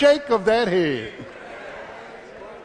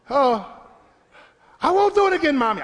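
put-on screaming child; 6 semitones higher than usual. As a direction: wail it like a kid getting a whipping. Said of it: "and you screaming saying".

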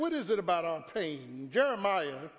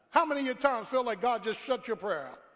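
ordinary talk

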